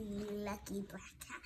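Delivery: plain reading voice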